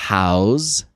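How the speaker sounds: each word alone with long gaps; slow, separated teaching pronunciation; slow word-by-word model. Shown as a demonstration. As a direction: say it word by word for learners to repeat. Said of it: "Speak aloud with me".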